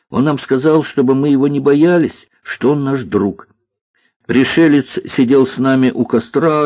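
put-on high, eager boy voice; увлечённый мальчик. Мальчик рассказывает о пришельце. (увлечённый мальчишка, высоким голосом)

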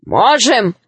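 firm reply in an ordinary register; gnome brothers. A narrator's reading. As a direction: back him up without squeaking. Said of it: "сказали его братья".

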